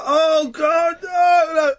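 high, hammed-up wail; mock crying. Fan reading the insult on her record, mock anguished wail. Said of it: "you'd be at home crying".

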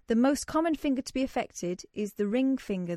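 normal talking voice

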